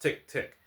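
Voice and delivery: high, crisp spoken tick-tick; loud clock ticking. A narrator's reading. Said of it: "The clock loudly measured".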